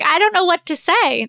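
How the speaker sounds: blurting it out in shock; stunned reply. Retold or imitated. Surprised by his proposal, she blurts it out high and loud. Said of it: "That's literally what came out of my mouth".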